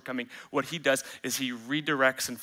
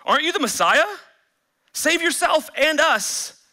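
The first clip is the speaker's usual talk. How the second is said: mocking taunt; 11 semitones higher, 7 dB louder than usual. Mocking criminal on the cross.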